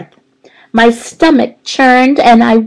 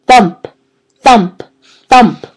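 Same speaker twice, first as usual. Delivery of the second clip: loud thumps; 2 semitones higher, 5 dB louder, slower than usual. A narrator's reading. Narrator thumping out the noise in the hall, louder. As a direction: knock out each thump like a fist on the door.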